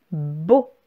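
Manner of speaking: syllabe détachée, nettement plus grave et douce; dictée articulée. Shown as a demonstration. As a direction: dicter lentement à l'élève. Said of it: "Qui va s'écrire". (voix de dictée grave et posée)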